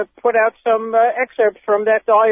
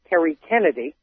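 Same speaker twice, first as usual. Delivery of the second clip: ordinary talk, nothing marked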